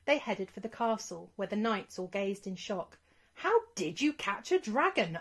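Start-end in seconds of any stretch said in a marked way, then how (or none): 3.3-5.2 shocked question. Acted. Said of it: "asked the biggest knight".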